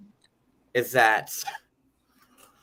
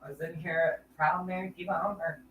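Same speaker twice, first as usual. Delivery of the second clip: ordinary talk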